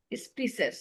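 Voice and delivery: স্পিসেস as 'spee-ses', on its own; correct pronunciation. Shown as a demonstration. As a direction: show স্পিসেস with an 'ee' in the first syllable. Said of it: "উচ্চারণটা হচ্ছে".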